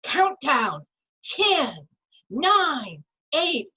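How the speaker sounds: put-on loud, high, gleeful countdown to the kids; excited countdown. Mother thrilling her kids in the elevator, loud excited countdown. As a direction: count down like a rocket launch. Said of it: "and I say".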